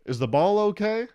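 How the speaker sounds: loud, high yell across the field. Retold or imitated. Comic Little League dad yelling from crowd, loud high shout. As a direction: call it out from the stands. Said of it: "he yells out".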